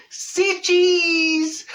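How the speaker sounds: high, loud say cheese; bright camera call; photographer's call. Retold or imitated. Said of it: "And I'm going".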